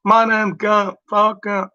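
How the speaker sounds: a slightly off Gump voice, not quite there; off Gump impression. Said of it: "you're a little off".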